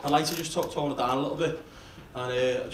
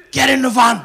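loud barked order, much louder; kidnapper shouting; 5 semitones higher, 13 dB louder than usual. Retold or imitated. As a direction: yell it like a thug grabbing you. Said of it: "he goes".

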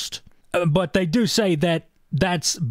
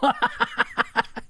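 high staccato laugh; high ha-ha-ha burst; 6 semitones higher, 3 dB quieter than usual. Real, not acted. Laughs at the awful game.

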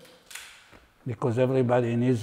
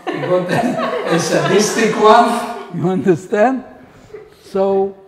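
laughing; 7 semitones higher, 11 dB louder, slower than usual. Real, not acted. Laughing at own joke punchline.

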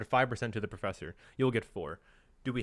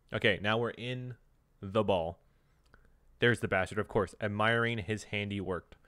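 ordinary delivery

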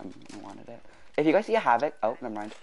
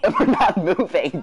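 laughing; 5 semitones higher, 5 dB louder than usual. Real, not acted. Laughing frustration.

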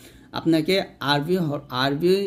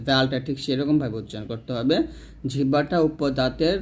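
ordinary delivery

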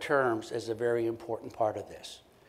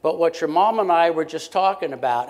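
louder, raised call to get through the earphones; calling over to the teen; 4 semitones higher, 8 dB louder than usual. Shown as a demonstration. Therapist calling to a teen with earphones in, loud. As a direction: call across the room so he can't miss it. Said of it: "Or I'll just turn to them and say".

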